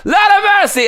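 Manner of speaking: a huge, very high screamed cry at full volume; screaming shout. Real, not acted. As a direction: let it rip, scream it out with everything. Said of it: "I wanna give you a screaming".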